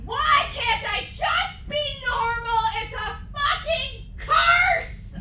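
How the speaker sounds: high, sing-song melodramatic shout; over-the-top angsty shriek. Acted. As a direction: wail it like a tortured teen. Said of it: "I shouted".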